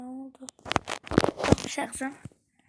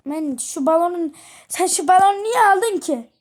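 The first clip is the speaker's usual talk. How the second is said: put-on loud, higher scolding of the child; 5 semitones higher, 9 dB louder than usual. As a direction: snap at her over the balloon. Mother scolding child over balloon. (balon yüzünden çocuğa bağıran anne, öfkeli)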